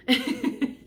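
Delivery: short bright giggle at herself; giggle, amused at yourself; high giggle. Real, not acted. Laughs at own sing-song.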